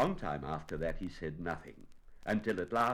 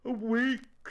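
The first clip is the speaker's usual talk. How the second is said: high, loud bear lament; gloomy; 7 dB louder than usual. A narrator's reading. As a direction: moan it as a dismayed bear. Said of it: "said Winnie the Pooh gloomily".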